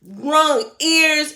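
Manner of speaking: normal talking voice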